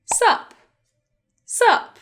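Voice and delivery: "Sup" as one high syllable; shortened What's up. Shown as a demonstration. Teacher demonstrating the young and cool greeting, shortened "Sup". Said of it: "sometimes this is abbreviated, so shortened".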